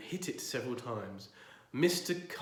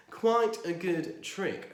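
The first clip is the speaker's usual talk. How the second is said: pompous neighbour; 7 semitones higher, 6 dB louder, much slower than usual. Acted.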